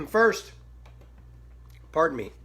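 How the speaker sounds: clearing a catch in the throat; coughy, choked-up throat; throat tickle. Real, not acted. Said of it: "I'm choking".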